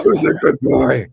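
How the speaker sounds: put-on a lightly growled dog-baby voice; guttural baby voice. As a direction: coo at the dog with a rough throat. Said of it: "It's the baby voice with the gutturalness".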